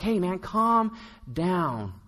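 slow, soft, drawn-out hush; nervous disciple slowly calming the beggar. Retold or imitated. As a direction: soothe him into being quiet. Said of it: "So like".

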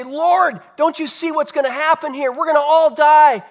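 high, loud alarm, acted out; mock panic, rising to a loud peak; panicked cry. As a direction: cry out as a frightened disciple waking his master. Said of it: "in an absolute panic".

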